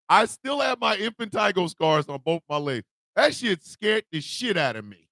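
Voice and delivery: usual speaking voice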